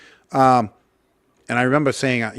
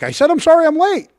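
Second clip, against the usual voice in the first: put-on high, loud frightened kid's yell of sorry; shouted apology; 6 dB louder than usual. Boy shouting his apology at his angry father, loud and high.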